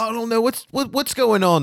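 high, flustered prisoner; confused captive. Acted. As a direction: stammer in confusion. Gazebo confused, still tied.

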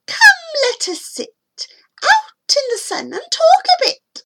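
put-on very high, cracked old lady voice; high-pitched elderly hag; old aunt. A narrator's reading. Old aunt's invitation voiced.

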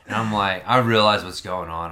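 ordinary talk, nothing marked

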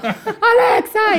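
high, long drawn-out name; mock desperate call. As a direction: call for rescue, stretching the name. Calling for help in the story. (llamado desesperado fingido, agudo y alargado)

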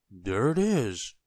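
loud, drawn-out exclamation; slow, swooping cry of discovery; triumphant cry. A narrator's reading. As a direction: point it out triumphantly. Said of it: "cried Mister Forgetful".

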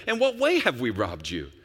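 mocking the people. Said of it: "But you say".

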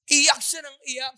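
loud, slow wailing narration; wailing as she weeps; crying out. A narrator's reading. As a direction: cry it out, high and loud. Preacher voicing weeping woman story.

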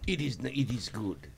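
put-on a deliberately horrible French accent; bad French accent. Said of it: "That's my horrible".

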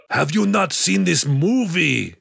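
slow, high-pitched put-on voice; exaggerated impression of an astonished man; incredulous mock character. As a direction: drag out a hammed-up disbelieving question. Imagined Stormare line, incredulous.

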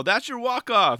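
loud, high imitation of a cheering onlooker; excited called-out praise; hollered approval. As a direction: holler it like a proud bystander. Acts out worker cheering the skater.

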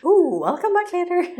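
high, bright-voiced retreating saleswoman; Avon lady. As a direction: back away sweetly, high and polite. Saleswoman backing off joke.

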